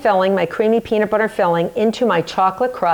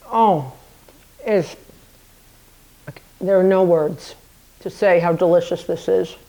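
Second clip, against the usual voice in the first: talking with a mouthful; words round a bite of tart; mouth full of peanut butter; 3 semitones lower than usual. Real, not acted. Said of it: "My mouth is full of peanut butter".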